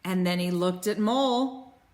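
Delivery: usual speaking voice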